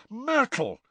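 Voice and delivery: sharp high cry of outrage; outraged yelp. A narrator's reading. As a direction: call her name in shock. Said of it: "Harry said in outrage".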